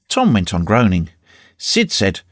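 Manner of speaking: ordinary delivery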